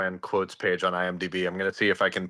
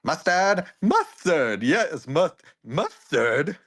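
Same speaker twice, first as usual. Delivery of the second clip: high, bright character voice; playful high-pitched character; 6 semitones higher, much slower than usual. Acted. Voicing a character, quoted line.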